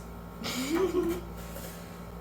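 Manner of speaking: bright high giggle; high laugh. Real, not acted. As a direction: laugh at the interruptions. Laughing at the interruptions.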